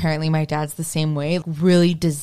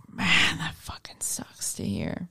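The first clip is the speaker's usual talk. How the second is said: breathy whisper, slow and hushed; 9 dB quieter than usual. Retold or imitated. Telling her friend how she took his words, in a slow whisper. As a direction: let it out as a stunned hush. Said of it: "And I was like".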